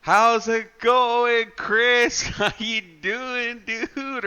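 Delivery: high, slow, chirpy greeting; Mark impression. Imitating Mark greeting a friend in chat, high and drawn out. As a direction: drag out a cheery hello. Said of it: "Mark pops up exactly like that".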